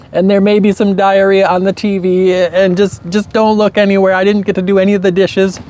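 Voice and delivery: high, pleading, frantic new mother; frazzled mom. Retold or imitated. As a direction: beg for a break at the end of her rope. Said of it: "she would be like".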